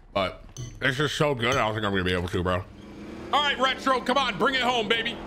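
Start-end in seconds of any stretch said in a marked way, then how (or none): 3.3-5.2 high-pitched rooting for a player; excited cheering. Real, not acted. Streamer urging Retro to bring it home, excited cheering. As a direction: urge him on, pitched way up.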